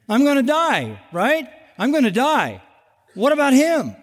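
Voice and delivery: high, whining mock Peter. Preacher voicing Peter to congregation, high whine. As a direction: play the fretting disciple, petulant and pleading. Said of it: "Peter is a constant project".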